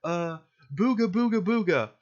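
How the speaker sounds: mock bigot voice. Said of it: "was like".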